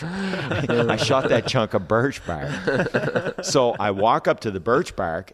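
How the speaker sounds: slow, high and loud through real laughter; real laughing disbelief. Real, not acted. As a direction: laugh at yourself while saying it. Laughs at own mistake.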